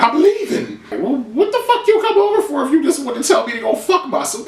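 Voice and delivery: high, sassy mock retort; imitating daughter snapping at her mom; mock Tommy. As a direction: fling it back indignant. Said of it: "And then she's like".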